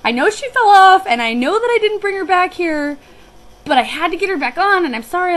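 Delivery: upset apology. Retold or imitated. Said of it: "I was so upset".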